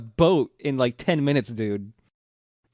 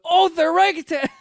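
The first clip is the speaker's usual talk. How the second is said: high, louder fond cry of the orangutan; delighted exclamation; 12 semitones higher, 4 dB louder than usual. Real, not acted. Cohost recalling the ape episode, high gleeful exclamation. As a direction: cry out with glee.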